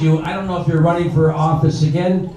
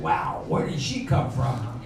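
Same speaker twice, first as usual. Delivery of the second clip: quiet wonder; 3 semitones lower, 11 dB quieter than usual. Retold or imitated. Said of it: "I said".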